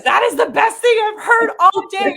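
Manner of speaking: high, loud delighted shriek; excited scream. Retold or imitated. Cashier thrilled by the customer's joke, shrieking. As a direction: squeal with sudden delight. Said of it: "she literally let out this big old scream".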